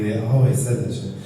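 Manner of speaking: normal talking voice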